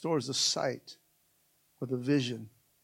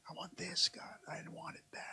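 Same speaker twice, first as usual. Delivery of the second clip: hushed prayer, almost no voice; very soft, private plea; whispered plea; 4 semitones higher, 10 dB quieter than usual. Acted. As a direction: fade to a whisper as you plead with God. Voicing a prayer to God.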